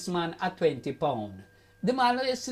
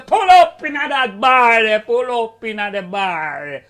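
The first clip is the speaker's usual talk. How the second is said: put-on 1 drunk uncle calling out; 11 dB louder, slower than usual. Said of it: "Him say".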